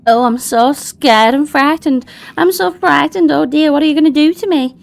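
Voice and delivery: high-pitched pretend fright; exaggerated doll-voice terror; mock frightened. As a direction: play up being scared, high and sing-song. Doll feigning fear at threat.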